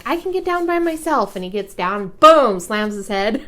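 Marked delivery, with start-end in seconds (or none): 0.0-1.4 put-on high, proud little-kid voice; a small boy bragging he can do it alone. Mom quoting her son before he jumps down, high proud kid voice. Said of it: "and then he's like".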